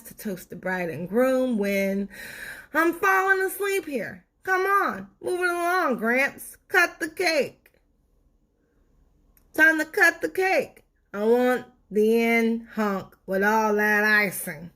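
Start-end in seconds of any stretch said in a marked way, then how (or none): 2.7-14.7 much higher, whiny heckling voice; impatient sweet tooth. Acted. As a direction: hurry Gramps along, bored and greedy. Tooth heckles the wedding toast.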